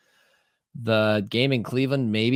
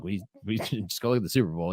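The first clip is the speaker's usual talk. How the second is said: ordinary talk, nothing marked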